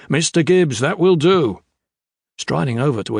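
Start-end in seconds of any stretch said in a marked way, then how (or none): 0.1-1.6 higher, louder order cutting in; officer silencing a sailor; commanding call. A narrator's reading. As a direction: call out with firm authority. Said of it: "a commanding voice called out".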